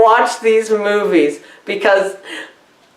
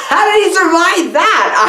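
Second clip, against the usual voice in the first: incredulous shout; 6 semitones higher than usual. Real, not acted. Incredulous about the plot.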